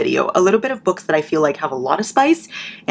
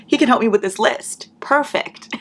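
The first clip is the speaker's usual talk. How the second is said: imitating a scheming girl; 6 semitones higher than usual. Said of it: "so she's like".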